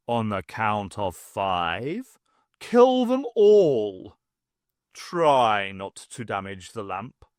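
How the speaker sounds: cold villain command. A narrator's reading. The greater one orders his men to kill them all. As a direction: give the death order coolly.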